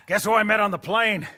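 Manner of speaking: bursting to tell the news; excited reveal, slowing on the name; eager news. Acted.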